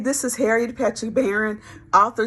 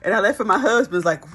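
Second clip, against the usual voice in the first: normal talking voice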